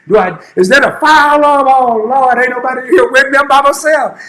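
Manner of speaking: put-on a scared nineteen-year-old alone, voice climbing; panicked. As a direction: sailor alone in the bathroom hearing the alarm, mildly panicked. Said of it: "I'm like".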